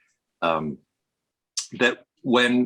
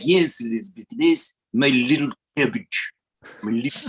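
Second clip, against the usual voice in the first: Prince Charles. Acted. American mimicking royals from a drama in old-fashioned British accent. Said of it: "the way Prince Charles speaks".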